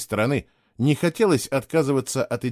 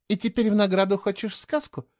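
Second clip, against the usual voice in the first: high-pitched teasing question; mocking; 9 semitones higher than usual. A narrator's reading. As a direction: needle them with mock sweetness. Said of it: "насмешливо спросила она". (насмешливо, высоким голосом)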